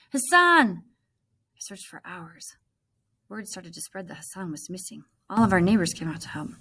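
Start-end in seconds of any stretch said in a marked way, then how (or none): 0.1-0.8 loud, high call of a name. Acted. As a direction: shout it into the crowd. Searching, calling his name.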